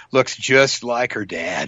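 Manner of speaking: put-on slow, measured verdict on a child; lightly voiced onlooker. Onlooker commenting on a kid's looks, slow verdict. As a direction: draw it out as a lightly acted observation.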